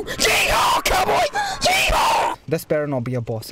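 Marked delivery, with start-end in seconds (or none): 0.0-2.4 excited yeehaw. Real, not acted. Player whooping as he charges the enemy, high excited yell. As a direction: whoop it like a rodeo rider.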